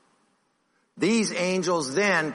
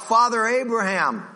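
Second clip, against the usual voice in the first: calling out; 8 semitones higher, 4 dB louder than usual. Acted. Rich man crying out from flames.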